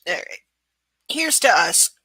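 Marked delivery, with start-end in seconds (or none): none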